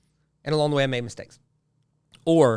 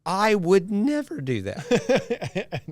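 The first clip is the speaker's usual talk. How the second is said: smug denial; 9 semitones higher, 5 dB louder, much slower than usual. Pretend. Smug reader mocks himself.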